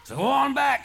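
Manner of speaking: high, loud triumphant yell; triumphant shout. A narrator's reading. Charlie sends the knocked serpent home, loud triumphant yell. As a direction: crow it over the beaten serpent.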